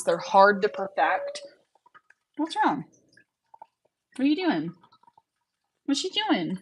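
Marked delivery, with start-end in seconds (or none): 2.4-6.6 high, soft coo. Real, not acted. Streamer coos high and soft to her rabbit. Talking softly to a pet.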